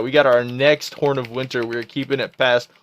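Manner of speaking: usual speaking voice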